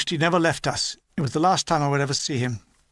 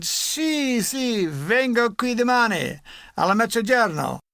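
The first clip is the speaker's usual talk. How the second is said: put-on higher, slow Italian old vendor voice; 5 semitones higher, slower than usual. Old vendor tells the soldier to come tomorrow, in Italian. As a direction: play the old Italian street trader answering. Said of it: "Immediately he said".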